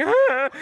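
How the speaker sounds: a high yelp, the voice jumping up sharply; mock startled yelp. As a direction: yelp as if the cat lunges. Scared by spanked cat.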